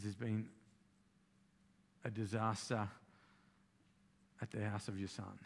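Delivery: soft, low and halting; grave hush with heavy pauses; breaking tragic news. Retold or imitated. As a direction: play a messenger reluctant to go on. Employee breaks news of son's house.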